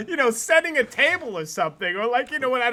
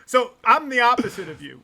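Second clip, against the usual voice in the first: usual speaking voice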